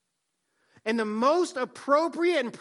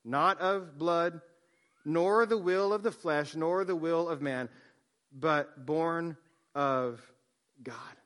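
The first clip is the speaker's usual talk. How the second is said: slow, reverent reading, sinking to a hush; slow solemn scripture reading fading out; 4 semitones lower, slower than usual. Acted. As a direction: lay each phrase down slowly like a verse.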